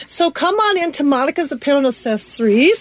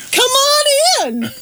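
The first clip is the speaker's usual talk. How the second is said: shouting; 8 semitones higher than usual. Acted. Shouted invite, mid-laugh.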